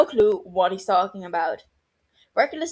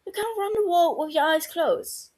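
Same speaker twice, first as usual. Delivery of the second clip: smiling; 9 semitones higher than usual. A narrator's reading. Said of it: "she smiles".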